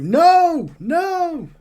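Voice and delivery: high-pitched mock referee yell. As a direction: shout frantic orders in a put-on high voice. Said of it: "the referee's yelling at you".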